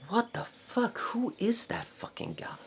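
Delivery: high-pitched, incredulous questioning; retold bafflement. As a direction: squeak it out in mock disbelief. Retold baffled reaction on sighting him.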